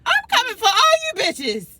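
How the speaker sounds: high, loud cocky threat; taunting shout. Acted. Inner bully warning everyone, high and loud. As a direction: call it out like a battle cry.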